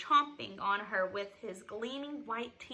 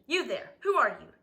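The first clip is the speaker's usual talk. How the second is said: loud, demanding challenge from a curmudgeon; gruff demand; 8 dB louder than usual. A narrator's reading. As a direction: demand it loudly of a stranger. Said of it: "he demanded".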